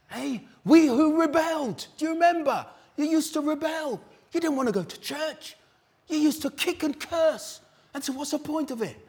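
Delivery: high, loud challenge to the congregation; full-force preaching. Acted. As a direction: preach it hard, pressing them. Preaching peak about past rebellion.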